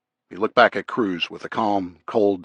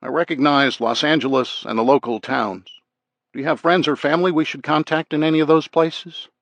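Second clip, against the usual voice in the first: higher, lightly solicitous questioning; concerned parent act; 6 semitones higher than usual. A narrator's reading. Officer questioning gang prisoner, with a hint of concerned parent. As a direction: play at being a caring parent. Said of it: "put on his best concerned parent act".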